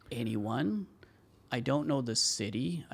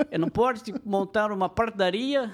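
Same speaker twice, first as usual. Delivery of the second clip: higher, louder European Portuguese accent; 8 semitones higher, 5 dB louder than usual. Shown as a demonstration. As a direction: switch to the Portugal accent, pushed up and out. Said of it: "se você quer em português do Portugal".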